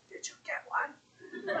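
hushed, breathy whisper on waking; a half-asleep hunter whispers up in the tree stand. Retold or imitated. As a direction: whisper it so the deer won't hear. Sleeping friend wakes, asks quietly.

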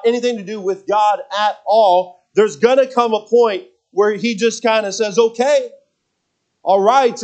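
mounting sermon climax; preaching. Acted. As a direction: drive the sermon home to the congregation. Preaching builds to full force.

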